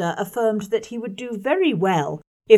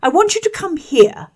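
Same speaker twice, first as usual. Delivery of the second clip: bossy schoolboy; 10 semitones higher, 7 dB louder than usual. Acted. John orders Jane over.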